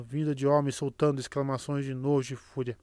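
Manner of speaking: ordinary delivery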